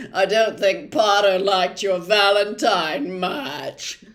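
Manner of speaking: loud, spiteful jeering shout; spiteful jeering yell. A narrator's reading. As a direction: yell it after her to hurt. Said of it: "he yelled spitefully after her".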